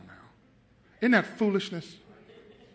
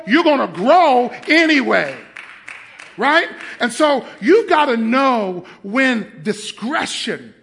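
raised, high preacher's voice; full-force preaching; 6 semitones higher, 6 dB louder, slower than usual. Real, not acted. Pastor warns his church to value the Word, preaching hard. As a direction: drive it home to the congregation, loud.

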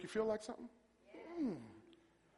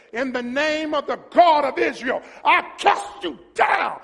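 loud, raised, slowing declaration; confident battle cry; 3 semitones higher, 6 dB louder than usual. Acted. David declaring victory to Goliath, confident and loud. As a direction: proclaim it boldly, stretching the final words. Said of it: "difference when you're confident".

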